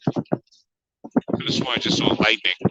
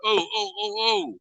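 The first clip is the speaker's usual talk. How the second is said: startled oh; 10 semitones higher, 5 dB quieter than usual. Real, not acted. Startled while riding fast downhill.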